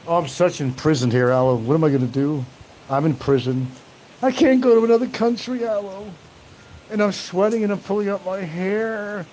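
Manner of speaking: hammed-up mock whining prisoner. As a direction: whine like a trapped prisoner, hammed up. Mocks trolls' imagined whining.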